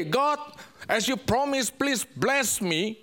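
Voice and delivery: ordinary delivery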